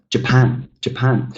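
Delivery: "Japan" stressed on PAN; light Ja, weight on PAN in "Japan"; stress on second syllable. Shown as a demonstration. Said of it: "the stressed syllable is the second one".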